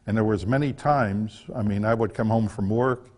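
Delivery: ordinary talk, nothing marked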